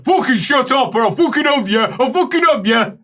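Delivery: high, worked-up shouting; acted angry threat. Patient threatening the laughing neighbour, acted angry yell. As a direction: threaten the man laughing at you.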